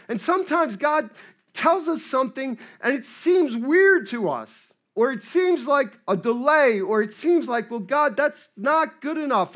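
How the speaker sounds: full-voiced, animated preaching; impassioned preaching. Real, not acted. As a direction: press the point on the congregation.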